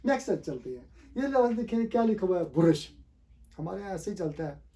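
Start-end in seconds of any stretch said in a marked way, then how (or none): 2.4-2.9 "ब्रश" broken up as bu-RUSH; extra u between b and r in ब्रश; wrong pronunciation. Shown as a demonstration. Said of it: "it's not brush".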